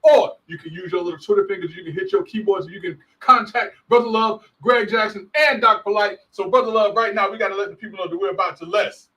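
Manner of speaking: high-pitched, punchy show intro; hype announcer. Acted. Co-host introduces the podcast duo to listeners, hyped announcer voice. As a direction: pump up the audience like a radio announcer.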